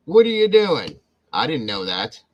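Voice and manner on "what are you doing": playful call-out. Real, not acted. Teasing a viewer who skipped work.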